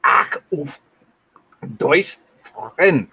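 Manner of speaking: slow, careful stab at German; halting German try; attempted German. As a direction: sound it out like a German phrase. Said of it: "valiant effort".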